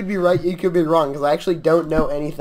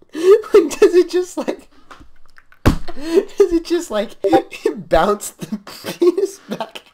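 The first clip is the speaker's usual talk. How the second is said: high, loud words squeezed through laughter; helpless laughter; 11 semitones higher, 4 dB louder, slower than usual. Real, not acted. Joking to friends about the spring, laughing hard. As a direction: crack up while trying to get the question out. Cracking up at metal spring idea.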